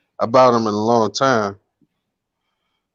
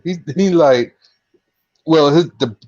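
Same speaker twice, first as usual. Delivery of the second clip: ordinary talk, nothing marked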